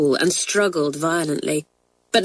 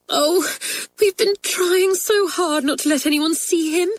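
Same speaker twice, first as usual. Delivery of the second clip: high, pleading voice close to tears; a girl fighting back tears, much higher; almost in tears; 11 semitones higher than usual. A narrator's reading. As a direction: Kathleen begs not to be caught, tearful. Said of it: "almost in tears".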